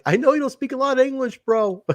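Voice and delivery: high-pitched mock-earnest address; Russo. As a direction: mimic him in a high, amused voice. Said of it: "Russo's like".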